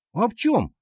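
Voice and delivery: high, rising puzzlement; surprised question. A narrator's reading. Class asking the teacher the real reason, surprised. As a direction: ask it high, taken aback. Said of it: "удивились мы".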